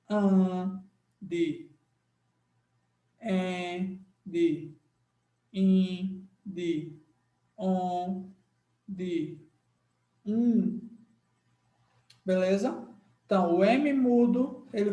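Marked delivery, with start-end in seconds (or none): 0.1-10.8 an, en, in, on, um each held as a nasal vowel; nasal vowel demo. Shown as a demonstration. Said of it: "o som nasal de". (vogais nasais pronunciadas uma a uma)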